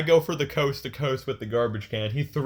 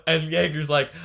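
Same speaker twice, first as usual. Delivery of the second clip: usual speaking voice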